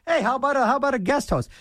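a hint of an eager coworker; imitating Mark. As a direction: toss out the idea like a hopeful colleague. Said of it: "there's Mark goes".

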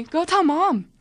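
high, loud eager order; excited urging. A narrator's reading. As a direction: send her off with the news. Sister urges her on.

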